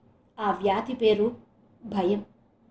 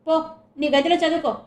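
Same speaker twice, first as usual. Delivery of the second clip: loud, higher, dismissive order; angry scolding; 5 semitones higher, 5 dB louder than usual. Acted. Mother orders daughter to her room, angry. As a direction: send her off in anger. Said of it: "అంటూ తిట్టింది".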